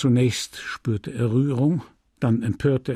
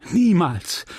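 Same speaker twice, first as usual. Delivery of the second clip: impetuous; 5 semitones higher, 4 dB louder than usual. Acted. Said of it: "sagte sie ungestüm".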